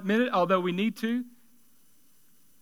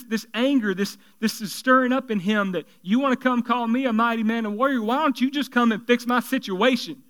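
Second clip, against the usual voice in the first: normal talking voice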